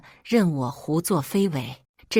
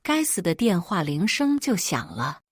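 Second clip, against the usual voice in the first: ordinary delivery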